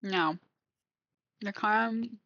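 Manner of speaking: talking through a mouthful of chocolate; words round a bite of food; chewing while talking. Real, not acted. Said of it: "I really shouldn't try fork while I'm eating".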